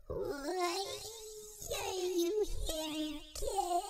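put-on very high, spooky old-lady voice. A narrator's reading. Old lady calls from a different room, high and eerie. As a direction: draw it out as an eerie old woman. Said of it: "the voice of an old lady".

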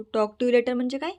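ordinary delivery

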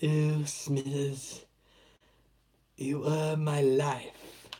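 slow, put-on old villain voice; Mr Burns voice. Burns swooning over Smithers, slow put-on voice. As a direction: drawl it like Mr Burns, fond.

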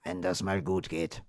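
low-volume sceptical mumble; very soft, doubtful aside; muttered doubt. A narrator's reading. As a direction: mutter it half to yourself. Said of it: "murmelte Thomas".

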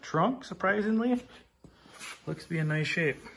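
pleasantly surprised. Real, not acted. Showing viewers the old sled's trunk, pleasantly surprised. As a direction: pitch jumps high, slowed on trunk.

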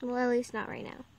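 normal talking voice

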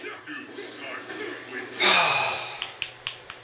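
long, heavy exhale; exasperated sigh. Real, not acted. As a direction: let out a long frustrated breath. Exasperated after failed inputs.

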